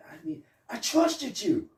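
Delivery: high pleading put-on voice; hurt mock voice. Acting the betrayed partner.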